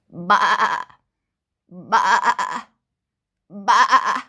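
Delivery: loud, low, wobbling sheep "baa"; sheep bleat. Pretend. Storyteller answering what a sheep says, loud playful bleating. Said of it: "A sheep says".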